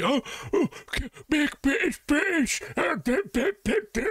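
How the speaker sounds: high, whiny mockery of a flag-waver; mock British patriot. Mocking patriots who chant about making things British. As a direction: squeak it out like a pompous patriot. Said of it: "always so hilarious when you see".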